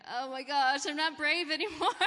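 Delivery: loud, high, laughing through mock panic. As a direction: play-act losing your nerve, laughing. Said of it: "and you're like".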